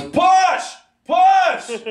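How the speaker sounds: high, loud "Push!" like coaching a birth; hammed-up birthing coach shout; mock midwife shout. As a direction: yell it like a delivery nurse. Mock delivery-room coaching shout.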